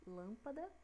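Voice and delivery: Lâmpada stressed on LÂM; stress on first syllable. Shown as a demonstration. Teacher repeating options to pupils, Lâmpada stressed on LÂM. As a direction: hold the LÂM of Lâmpada, questioning rise. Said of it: "Vocês observaram a diferença?".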